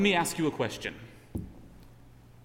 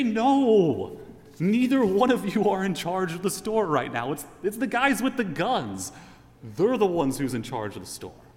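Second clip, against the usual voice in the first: emphatic; 6 semitones higher, 5 dB louder than usual. Acted. Hostage answering the CEO.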